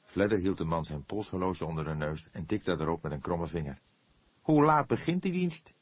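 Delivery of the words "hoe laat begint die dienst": higher and louder, brusque; rude question. A narrator's reading. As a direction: demand it impolitely. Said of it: "vroeg hij onbehouden". (onbeleefd en bot, hoog en luid gevraagd)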